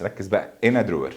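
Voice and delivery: ordinary talk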